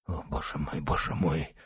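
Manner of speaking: soft, low moan of despair. A narrator's reading. Dying executioner calls on God, moaning. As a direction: moan it weakly, barely voiced. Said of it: "стонал палач".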